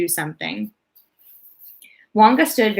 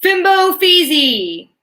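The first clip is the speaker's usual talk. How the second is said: very high, loud, slow scream; frenzied unearthly scream; 10 semitones higher, 6 dB louder, much slower than usual. A narrator's reading. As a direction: scream the nonsense words wildly. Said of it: "frenzied, unearthly sort of scream".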